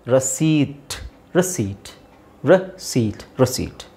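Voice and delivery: ರಸೀಟ್ low and repeated, long ee into T; silent P drill. Shown as a demonstration. Teacher drills learners on silent P, ರಸೀಟ್ without P. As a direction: drill ರಸೀಟ್ as ra-seet, leaving out the P. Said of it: "ಪಿ is silent".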